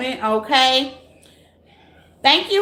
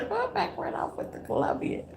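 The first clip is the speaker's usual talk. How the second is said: breathless whisper through giggles; giggly whispered question; 8 semitones higher, 12 dB quieter than usual. Real, not acted. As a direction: whisper it while laughing.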